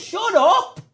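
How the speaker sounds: high-pitched shout through the wall; mock yell. Presenter telling off the noisy producer next door, mock yell.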